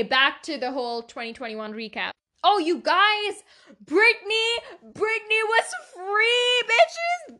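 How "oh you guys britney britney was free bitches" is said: high, loud, cheering cry; ecstatic shout. Real, not acted. Fan shouts to podcast listeners that [name] is free, high cheering scream.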